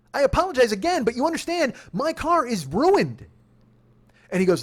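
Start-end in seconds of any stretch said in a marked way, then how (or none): 0.1-3.3 high, pleading indignation; raised voice. Retold or imitated. Driver pleading with the cop over his car, voice raised. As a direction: plead your case, stretching the last words. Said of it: "come over here and raise your voice".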